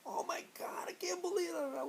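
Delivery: very high, slow, strained squeal of disbelief; laughing Dwayne. Retold or imitated. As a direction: squeal it out slowly, as if amazed and amused. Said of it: "Dwayne's laughing. He's like".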